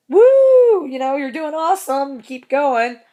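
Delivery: high excited whoop then encouraging call; loud whoop of encouragement; cheering. Retold or imitated. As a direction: cheer the band on like a fan at a gig. Cheering on the opening band.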